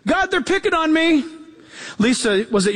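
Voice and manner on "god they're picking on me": high, plaintive mock prayer like a kid tattling; hammed-up complaining child crying to God; whiny child praying. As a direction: whine it up to God like a picked-on child.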